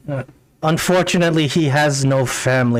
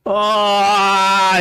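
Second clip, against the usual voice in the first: put-on anguished old king's cry; 7 semitones higher than usual. The mad old dwarf cries out to the party in anguish. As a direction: let out a pained wail as he drops. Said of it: "he screams out".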